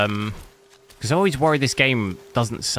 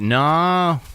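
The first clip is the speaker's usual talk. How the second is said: long Australian "no" swooping upward; attempted Australian accent; 5 semitones higher than usual. Shown as a demonstration. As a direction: stretch an Aussie "no", pitch climbing. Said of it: "I can't even do it".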